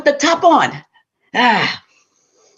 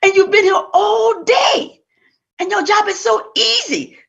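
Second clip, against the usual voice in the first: high, shouted scolding husband; mock-outraged husband yelling; 10 semitones higher than usual. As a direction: berate her like a smug husband. Husband scolding wife in folktale.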